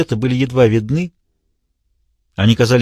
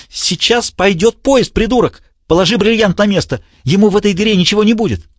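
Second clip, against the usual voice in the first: high, taunting madman's voice, racing at the end; frantic villain; 8 semitones higher than usual. A narrator's reading. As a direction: jeer at him, higher and higher.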